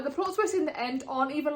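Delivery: ordinary talk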